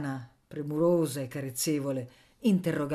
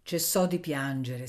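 ordinary delivery

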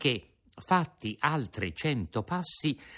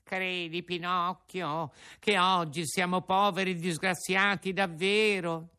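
high, pleading whine; sly fox begging in a whimper; whining fox; 8 semitones higher than usual. Acted. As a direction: beg pitifully, fox style.

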